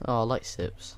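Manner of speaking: ordinary talk, nothing marked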